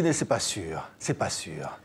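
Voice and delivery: lightly voiced dry director. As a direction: drop low and quiet, teasing. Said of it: "Il vous dit".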